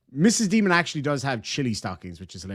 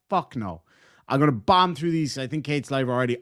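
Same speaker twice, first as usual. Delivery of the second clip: normal talking voice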